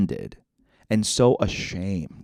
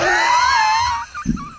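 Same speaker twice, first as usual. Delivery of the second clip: very high shrieking cackle; shrieking laugh. Retold or imitated. Friend cracking up at the retort, high shriek. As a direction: let out a shrill shriek of laughter.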